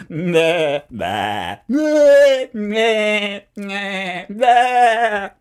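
high, loud bleats; sheep bleating. A narrator's reading. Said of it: "All the farmer heard was".

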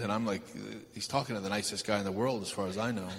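usual speaking voice

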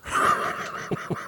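silent wheezing laugh; breathless laugh. Real, not acted. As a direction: laugh without voice, helplessly amused. Laughing at the story he reads.

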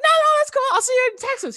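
very high, loud flustered reply; retold giddy fan. Starstruck caller brushing off question, giddy squeal. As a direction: blurt it in an excited squeal. Fan hurriedly ending call with idol.

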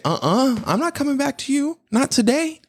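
high, sassy refusal; sassy dog voice. Retold or imitated. Dog refusing to come back, high sassy voice. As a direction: turn it down with attitude. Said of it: "The dog's gonna be like".

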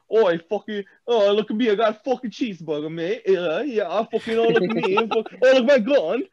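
mock British accent, high and loud, jeering. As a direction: taunt Americans in a hammed-up British accent. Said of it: "we hear a Brit fucking like".

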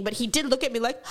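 ordinary talk, nothing marked

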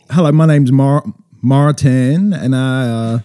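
drawling, Southern-sounding American accent; amateur American accent with a Southern twang. Shown as a demonstration. New Zealand guest tries his best, Southern-tinged American accent. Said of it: "Martin, you go. American".

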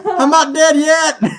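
milk the comeback, stretching it; hammed-up defiant shout; mock triumphant cry. Downed hero gasps back to life.

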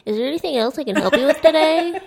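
chirpy scripted service voice; chirpy over clear scripted. Retold or imitated. Customer service voice.

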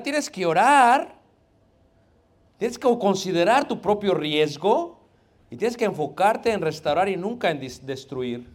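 slow, loud, hammering each step; emphatic preaching. Acted. Pastor urging the church to restore brothers, slow emphatic preaching.

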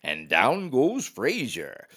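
slow, drawn-out broadcaster call; slow play-by-play announcer voice; Howard Cosell impression. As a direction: call it like a boxing announcer, stretching each word. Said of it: "in his very best Howard Cosell voice".

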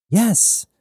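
bright, high burst of joy; gleeful. Acted. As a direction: blurt it out with delight. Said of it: "Jazz responded with glee".

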